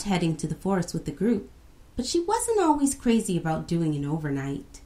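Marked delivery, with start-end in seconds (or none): none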